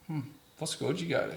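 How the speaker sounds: quietly probe, quick and high; retold probing question. Man to fellow Brisbane native, softly probing.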